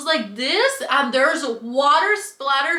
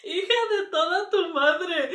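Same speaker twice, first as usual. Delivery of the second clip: laughing curse; 3 semitones higher than usual. Real, not acted.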